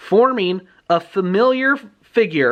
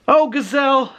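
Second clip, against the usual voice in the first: acted boy's yell, pitched way up; pleading yell; 11 semitones higher than usual. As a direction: beg someone to get up in a high, stretched cry. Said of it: "Skipper's yelling".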